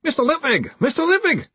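high calling yell; high call for attention. Acted. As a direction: shout across the room. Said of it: "someone yelled".

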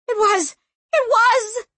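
high, loud insistent scream. A narrator's reading. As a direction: scream it over any denial. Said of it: "she screamed".

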